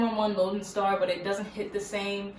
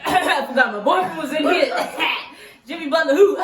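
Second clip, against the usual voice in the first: loud, high, laughing through the words; embarrassed laughing; 8 semitones higher, 9 dB louder than usual. Real, not acted. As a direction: laugh it off, caught out. Caught praising players before boyfriend.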